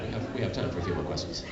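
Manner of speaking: usual speaking voice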